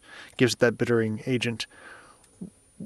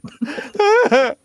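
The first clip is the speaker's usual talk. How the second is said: high laugh; 4 dB louder than usual. Real, not acted. Big laugh after joke.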